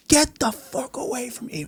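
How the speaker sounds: a high, slowed, half-hushed threat, retold; Mike Tyson snapping. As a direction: imitate a boxer losing patience with the crowd. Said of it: "all of a sudden, Mike goes".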